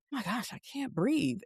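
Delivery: half-whispered can't-breathe panic; panicked gasp. Retold or imitated. Alone in the mall, she gasps in quiet panic.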